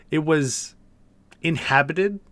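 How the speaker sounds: ordinary delivery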